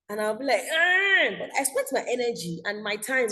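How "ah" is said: high, loud frustrated "ah"; angry outburst. Retold or imitated. Teacher relives being peed on by the baby, angry cry. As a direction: let out an exasperated yell, retold. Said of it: "I was literally so angry".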